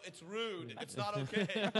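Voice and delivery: laughing scold. Pretend. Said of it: "and I go".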